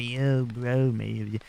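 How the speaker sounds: lightly voiced laid-back surfer bro, fading out; mock hippie bro, easy and drawn. Imitating a long-haired surfer type, a hint of stoner bro. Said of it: "you know, the yolo surfer".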